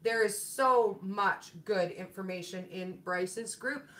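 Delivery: usual speaking voice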